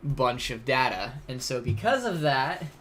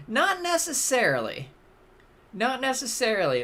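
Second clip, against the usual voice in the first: emphatic objection; 10 semitones higher, 4 dB louder than usual. Real, not acted. Protesting the download estimate.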